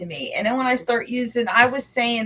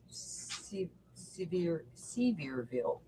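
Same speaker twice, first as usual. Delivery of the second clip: low, soft British-sounding attempt; halting British-style pronunciation; British accent; 5 semitones lower, 17 dB quieter, slower than usual. Shown as a demonstration. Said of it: "You sound like you had a British accent".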